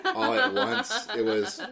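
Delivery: high, laughing through words. Real, not acted. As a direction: giggle it out. Laughing at funny gif.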